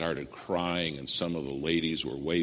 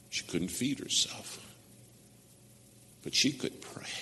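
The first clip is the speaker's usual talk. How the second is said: hushed whisper; 2 semitones higher than usual. Real, not acted. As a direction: hush the room with a tender memory. Tender memory of praying mother.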